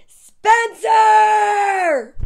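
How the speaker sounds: loud, high, drawn-out call; yelling mom. A narrator's reading. Fed-up mom calling her son from downstairs, yelling. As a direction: holler the name up the stairs. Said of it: "she yelled".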